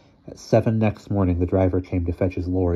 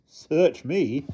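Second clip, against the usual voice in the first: lightly voiced driver, higher pitched; offhand driver; 8 semitones higher than usual. A narrator's reading. Lorry driver answers the distraught owner, offhand. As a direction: toss it off with a shrug.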